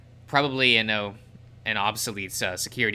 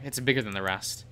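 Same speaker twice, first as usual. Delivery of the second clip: ordinary delivery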